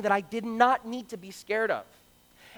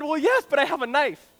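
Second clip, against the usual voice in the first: put-on proud kid; 5 semitones higher, 4 dB louder than usual. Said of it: "And I said".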